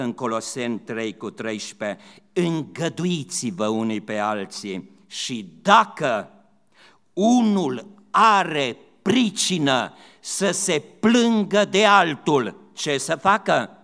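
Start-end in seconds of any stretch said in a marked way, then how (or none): 2.3-13.7 impassioned preacher quoting Paul, growing louder and higher; fervent scripture reading. Acted. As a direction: lift the verse up to the whole church. Scripture quote, rising intensity.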